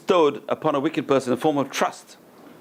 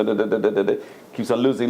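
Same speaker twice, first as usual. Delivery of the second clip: ordinary talk, nothing marked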